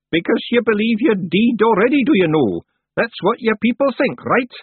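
high, loud character voice; challenging old witch. A narrator's reading. Miss Treason challenging Rob's beliefs, high sharp witch.

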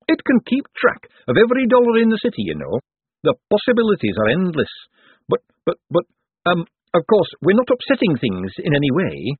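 high, gushing boffin that stumbles into stammering; enthuse, then backpedal nervously; awkward eager economist. Acted. Voices Hubert, an awkward economist.

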